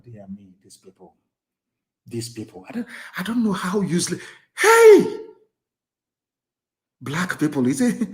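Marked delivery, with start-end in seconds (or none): 4.6-5.2 disbelieving shout. Real, not acted. Outburst of disbelief.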